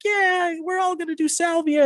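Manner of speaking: very high, cheering falsetto; mock group excitement. Friends all eager to do salvia, mock excitement. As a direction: imitate an overexcited crowd. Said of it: "everyone's like".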